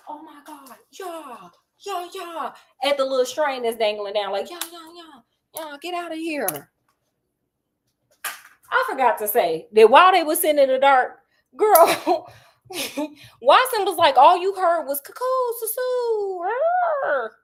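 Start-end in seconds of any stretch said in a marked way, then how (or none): 0.1-6.7 high, much softer, breathless amazement; awed hush at a dangling string; stunned hushed gasp. Retold or imitated. 15.0-17.3 mock animal calls. Imitating jungle animal noises.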